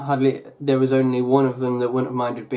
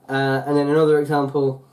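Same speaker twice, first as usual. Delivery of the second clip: usual speaking voice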